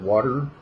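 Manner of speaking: "water" with the T turned into a D; D sound in water. Shown as a demonstration. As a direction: say "water" as "wah-der". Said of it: "more of a D sound".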